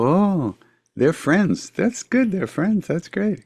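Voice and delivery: high, slow, sing-song approval; beaming approval of the couple; guru's approving voice. Retold or imitated. Guru approving the friendship, high and sing-song.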